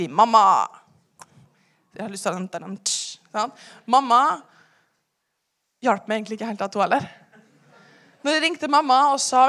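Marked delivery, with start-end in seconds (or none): none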